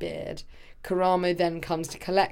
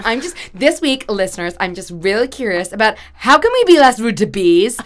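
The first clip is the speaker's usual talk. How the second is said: louder, mock-earnest podcast host; podcast host impression; 10 dB louder than usual. Podcast host addressing listeners about bees, hammed-up impression. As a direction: play an exaggeratedly curious host, building louder. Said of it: "you saying it to me in this dreadful accent".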